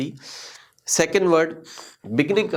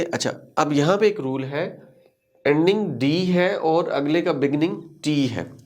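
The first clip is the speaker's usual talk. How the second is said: ordinary talk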